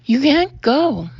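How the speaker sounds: Guy shouting after Samantha on the stairs; pleading shout. A narrator's reading. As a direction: call after her, desperate. Guy chasing her down stairs.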